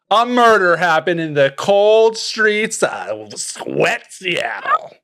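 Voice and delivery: high, slow, drawn-out noir-mystery intro; melodramatic case announcement. Pretend. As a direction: proclaim the murder like a hammy mystery host. Game master dramatically announces the case.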